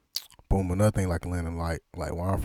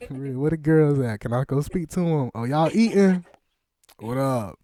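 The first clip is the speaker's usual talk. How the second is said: high, loud playful calling out; excited neighbor; 9 semitones higher, 3 dB louder than usual. Pretend. Interviewer pretends to drop by Miss Tierra's house, excited calling out. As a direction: call over like a nosy neighbor at the door.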